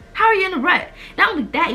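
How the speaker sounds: high-pitched incredulous retort, retold; retold exasperated challenge. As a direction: challenge him in exasperation, retelling the argument. Retells challenging dad over the money.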